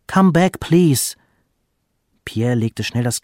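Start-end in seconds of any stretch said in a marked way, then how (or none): 0.1-1.2 higher, louder appeal; calling after her. A narrator's reading. Father urges departing wife to return, calling after her. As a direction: call out pleadingly as she leaves. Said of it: "rief der Vater ihr nach".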